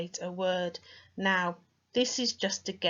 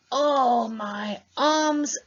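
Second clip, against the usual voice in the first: complaining; 3 semitones higher, 6 dB louder, slower than usual. Shown as a demonstration. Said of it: "We say".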